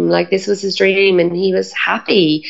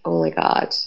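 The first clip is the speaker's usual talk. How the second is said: shocked whisper; 14 semitones lower, 4 dB quieter than usual. Retold or imitated. Mum shocked at her state.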